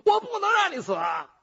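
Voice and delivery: high, loud, wailing plea; desperate jailer pleading with the prisoner; frantic plea. Acted. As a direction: cry it out in desperation.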